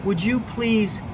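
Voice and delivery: put-on animated storyteller voice for kids; lively Bible story narration. Youth pastor telling kids a Bible story, animated narration. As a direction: tell it like a children's pastor spinning a tale.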